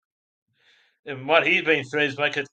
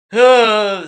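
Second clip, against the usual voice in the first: bursts into a loud laugh; real laugh; 8 semitones higher, 11 dB louder than usual. Real, not acted. Streamer laughing out loud at his chat's comments. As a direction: cracks up at the chat. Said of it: "this chat's making me laugh".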